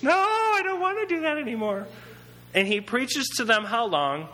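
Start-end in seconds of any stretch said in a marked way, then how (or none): 0.0-1.9 much higher, whining protest; whiny refusal. Retold or imitated. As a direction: whine it like a worn-out child. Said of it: "disciples refusing, worn out".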